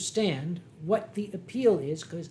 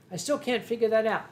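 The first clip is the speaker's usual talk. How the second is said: ordinary delivery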